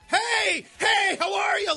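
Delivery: high, shouted across a parking lot; shouted greeting. Retold or imitated. Host shouting greeting to man across parking lot. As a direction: call out hey across the lot. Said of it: "like that across a crowded parking lot".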